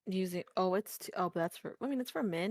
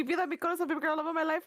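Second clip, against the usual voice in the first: mock lovesick lover; 7 semitones higher, 3 dB louder, much faster than usual. Lovesick Spanish quote as Sheik.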